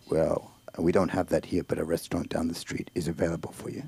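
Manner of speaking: very low, soft Mexican character voice; deep-voiced Mexican host, speaking softly; Mexican relative. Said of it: "That's my Mexican".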